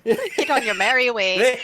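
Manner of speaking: slow, lilting Irish impression; Irish accent. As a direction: echo it in a mock Irish voice. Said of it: "Why did he become Irish?".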